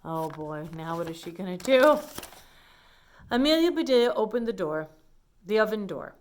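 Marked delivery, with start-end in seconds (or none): none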